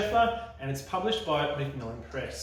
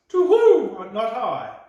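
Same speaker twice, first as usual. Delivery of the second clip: high, loud hooting owl; 9 semitones higher, 14 dB louder, slower than usual. Acted. Owl answers the Gruffalo's child with a hoot.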